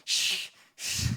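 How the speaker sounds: long angry huffs of breath; 씩씩거림. Acted. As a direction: huff loudly through a mock sulk. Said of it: "씩씩거리고". (화나서 씩씩거리는 숨소리)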